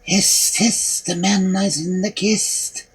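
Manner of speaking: breathy, slow whispered call; eerie whisper. A narrator's reading. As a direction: hiss it like a ghostly voice. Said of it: "called out and said".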